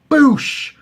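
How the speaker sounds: normal talking voice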